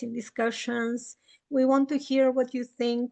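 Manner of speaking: usual speaking voice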